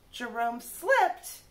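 usual speaking voice

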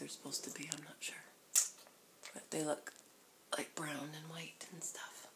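soft breathy whisper; low whispered aside. Real, not acted. Whispering near the sleeping baby.

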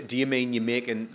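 normal talking voice

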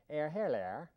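posh-English "oh, hello" made of air, hair, lair; mock English accent. Shown as a demonstration. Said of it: "then you run them all together".

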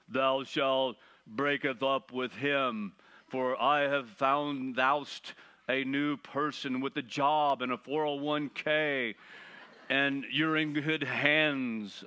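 slow, booming King James insurance-ad voice; Allstate guy in King James. Acted. God as insurance ad voice in King James, slow and grand. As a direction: intone it like a grand old-English pitchman, slowly. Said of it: "kinda like the Allstate insurance guy speaking in King James language".

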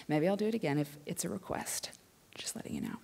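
soft whispered aside; hushed, breathy sneaky aside. Real, not acted. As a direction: confide it to the crowd. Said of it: "aside to audience".

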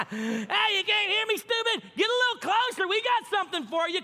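high, loud jeering taunts from the wall; mocking Jericho guards. Jericho soldiers on the wall taunting the silent marchers, loud high jeering. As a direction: jeer down at them like a bully on the ramparts. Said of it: "Imagine their enemies".

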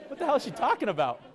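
high, loud incredulous retort; baffled disbelief. Retold or imitated. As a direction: blurt it out in mock bewilderment. Acting out his baffled inner reaction.

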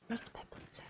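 unvoiced whisper. Real, not acted. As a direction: whisper it low. Aside to self.

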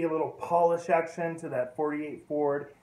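normal talking voice

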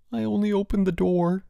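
high-pitched plea of innocence; implore, pitch held high; imploring. A narrator's reading. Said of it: "he implored".

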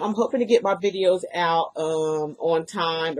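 normal talking voice

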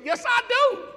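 high, loud comic insistence; exasperated shout. Retold or imitated. Preacher insisting he believes it, exasperated shout. Acted exasperated reply to doubter.

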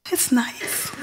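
lost voice, only a whisper left; voiceless whisper. Retold or imitated. Sad daughter answering her dad on the phone, voiceless whisper. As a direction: struggle to speak, no voice at all. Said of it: "I can't get my voice".

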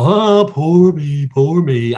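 hammed-up woe-is-me moan; exaggerated pity-party lament; mock self-pity. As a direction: whine it out, drawn-out and sorry for yourself. Mocks Elijah's pity party.

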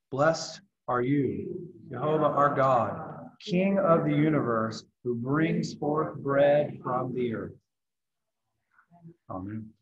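slow, solemn blessing; prayer. Acted. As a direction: intone it like a prayer. Reciting a blessing.

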